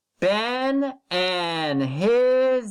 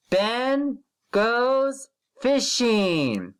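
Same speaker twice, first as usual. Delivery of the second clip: slow, drawn-out word-by-word reading; slow model reading; 4 semitones higher, much slower than usual. Shown as a demonstration. Teacher slowly modelling a sentence for first graders. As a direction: read it slowly so the children can follow. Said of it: "Here we go again".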